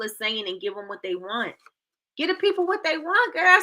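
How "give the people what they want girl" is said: sassy. Real, not acted. Sassy quote of a catchphrase.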